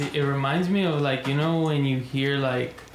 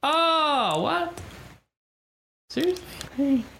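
surprised; 13 semitones higher, much slower than usual. Real, not acted. Shocked at mom drinking young.

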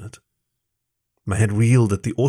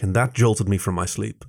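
ordinary delivery, nothing marked

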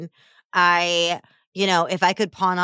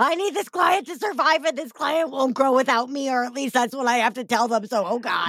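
higher anxious coach voice, mock; playing a needy coach, pitched up; codependent coach; 9 semitones higher than usual.

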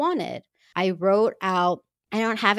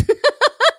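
high laugh; 10 dB louder than usual. Real, not acted. Laughs at her own story.

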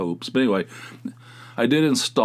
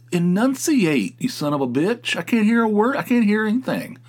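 indignant higher shout at the TV; exasperated yell; 11 semitones higher, 3 dB louder than usual. Real, not acted. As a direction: yell at the mumbling actor in mock fury. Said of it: "frustrated rant at mumbling".

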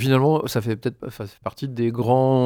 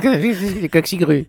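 laughing; 8 semitones higher, 5 dB louder, slower than usual. Pretend. Teasing the mangled word.

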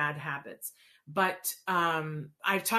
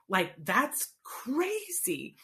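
slow, high, drawn-out disbelief; mock amazement at asking directly; incredulous thought; 6 semitones higher, much slower than usual. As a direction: stretch out that's crazy in disbelief. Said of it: "and I thought".

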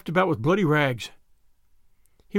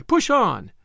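high, urgent call; urgent cry; 5 semitones higher than usual. A narrator's reading. Fleeing crowd warning those ahead, urgent high cry. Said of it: "was the cry".

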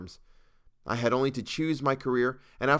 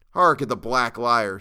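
louder, slow scornful jeer; 8 dB louder, slower than usual. A narrator's reading. Lynch mob leader mocks the captive, loud scornful jeer. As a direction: sneer it out to the crowd. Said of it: "said the man".

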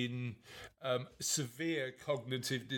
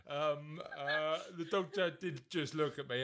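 ordinary talk, nothing marked

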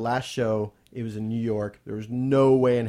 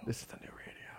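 hushed awestruck whisper; 14 dB quieter, faster than usual. Retold or imitated. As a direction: breathe it out in a whisper. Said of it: "Why are we whispering?".

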